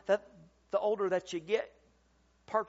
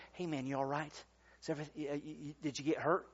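softer, lower voice checking on someone; gentle concern; 4 semitones lower, 7 dB quieter, faster than usual. Retold or imitated. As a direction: quote a quiet, concerned check-in, stumbling a little. Quoting himself checking on angry driver.

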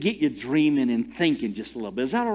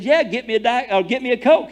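higher, louder casual request; own retold reply; 7 semitones higher, 6 dB louder than usual. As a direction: call back your order cheerfully.

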